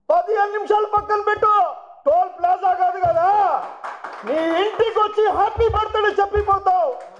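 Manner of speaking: taunting shout. Real, not acted. Taunting rival in press speech.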